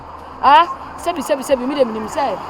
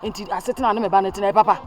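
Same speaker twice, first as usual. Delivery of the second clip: normal talking voice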